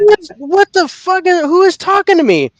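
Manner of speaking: high, startled mock question; retold fright. Hearing an unseen voice, high startled mock fright. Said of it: "and I'm like".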